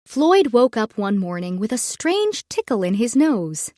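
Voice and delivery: usual speaking voice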